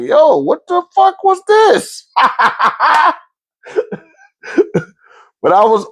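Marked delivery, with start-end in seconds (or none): 0.0-2.1 high, slow, laughing disbelief; reliving the stunned reaction, high and slow with a laugh; stunned laughing shout. Retold or imitated. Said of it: "I'm like". 2.1-3.1 high, far-off cackle; high laugh. Real, not acted. Laughing at own story.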